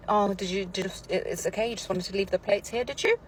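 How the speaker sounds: dance round the complaint, then lift sharply on the tag question; passive aggressive. Shown as a demonstration. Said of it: "Passive aggressive".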